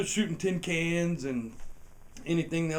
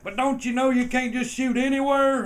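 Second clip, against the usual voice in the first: mock cop voice; 10 semitones higher, 9 dB louder than usual. Cop questioning, voiced higher.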